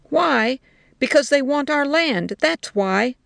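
high, forceful retort; loud and heated. A narrator's reading. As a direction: fire back with force. Soldier answers hotly.

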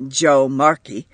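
ordinary delivery, nothing marked

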